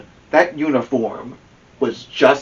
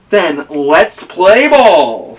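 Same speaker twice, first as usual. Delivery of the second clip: slow, louder "Then let's play ball"; challenging call; 5 semitones higher, 9 dB louder, much slower than usual. Acted. The Tornadoes challenge the Grizzlies, slow and loud. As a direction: rival team ready to start. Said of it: "said the Tornadoes".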